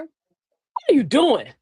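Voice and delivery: slow, drawn-out challenge; pointed, stretched-out question; accusing demand. Shown as a demonstration. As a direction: demand it like they're in trouble. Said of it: "we said 'em in two different energies".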